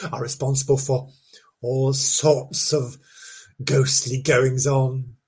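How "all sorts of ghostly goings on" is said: slow spooky drawl. Acted. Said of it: "spooky storytelling".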